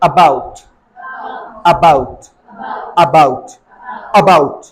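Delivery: "about" opening on a short weak uh, pitched low; low, separate model of "about" with schwa; half-A schwa. Shown as a demonstration. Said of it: "इसको पढ़ते हैं आधा आ".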